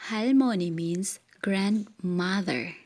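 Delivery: ordinary talk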